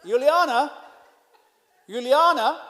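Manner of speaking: imitated call up the stairs; shouting upstairs. Host calling up to Juliana, shouting upstairs. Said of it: "I just shout up to Juliana".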